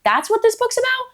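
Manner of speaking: delighted surprise. Retold or imitated. Reader reenacting finding an assassin in her book, surprised and thrilled. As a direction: pitch jumps way up in a gleeful discovery. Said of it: "I'm like".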